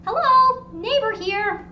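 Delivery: high, loud sing-out greeting; friendly neighbor calling. Acted. Neighbor announcing themselves at the front door, friendly call. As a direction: call a cheery hello at the door. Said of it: "come to the front door and, like".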